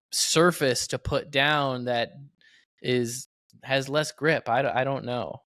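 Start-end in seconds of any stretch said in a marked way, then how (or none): none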